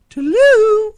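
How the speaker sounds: high, loud sing-song Toodaloo; squealed catchphrase. Acted. Co-host cuts off the host with the catchphrase, high squeal. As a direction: squeal Toodaloo like a cartoon sign-off.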